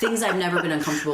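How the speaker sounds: laughing through the words, fast. Real, not acted. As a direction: giggle it out while rushing. Laughing at own awkwardness.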